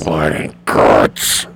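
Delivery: slow, dramatic announcer voice. Shown as a demonstration. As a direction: drag it out like a wrestling promo. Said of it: "You gotta say it right".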